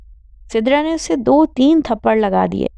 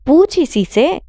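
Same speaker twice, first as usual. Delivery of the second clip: snapped, higher-pitched retort; curt angry reply; 6 semitones higher than usual. Acted. Sister telling mother to ask the brother, curt and angry. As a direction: throw the blame at him. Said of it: "Sidra نے غصے سے کہا".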